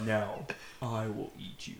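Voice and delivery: slow villain reveal; menacing villain. Acted. Villain unmasking to Sora, slow and ominous.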